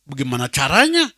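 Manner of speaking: normal talking voice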